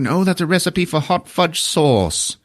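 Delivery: a hint of the befuddled wizard; lightly voiced wizard. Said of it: "He's like".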